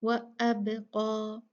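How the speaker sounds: अब का slow with a bounced, echoing ba; slow recitation with an echoing ba; qalqala bounce. Shown as a demonstration. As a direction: let the ba in अब का echo before का. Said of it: "थोड़ा सा हिलाकर पढ़ते हैं".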